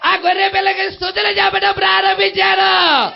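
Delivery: loud, high shouted climax; chant-like sermon shouting with long endings; full-force preaching. Acted. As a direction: proclaim the praise at the top of the voice.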